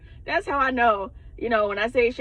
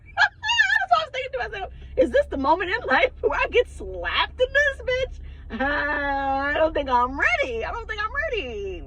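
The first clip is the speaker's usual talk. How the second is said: squeaky, high laugh-crying panic; half-laughing, half-whimpering with mock fear; 11 semitones higher, 3 dB louder than usual. Telling viewers about the scare, laughing high and whiny. Retold panic at possible slap.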